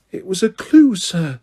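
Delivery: a hint of high elf voice; lightly voiced elf. Dobby explains.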